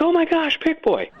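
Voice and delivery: high-pitched mock horror; imitated shocked cry. Acts out hosts' shocked reaction.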